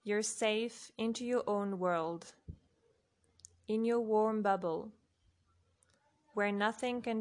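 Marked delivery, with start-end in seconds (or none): none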